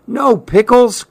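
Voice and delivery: high-pitched cry of dismay. A narrator's reading. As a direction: cry out over a small disaster. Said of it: "cried Susan".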